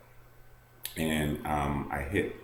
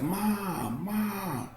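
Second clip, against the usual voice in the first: high, frantic call for mom; a scared kid crying out for his mother; child calling out in panic. Retold or imitated. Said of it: "that panic mom call".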